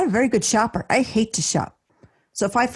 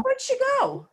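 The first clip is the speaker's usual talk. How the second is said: mock searching sons. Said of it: "I get that".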